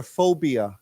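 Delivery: "phobia" said clearly for repeating; word modelling. Shown as a demonstration. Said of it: "let's say that word together".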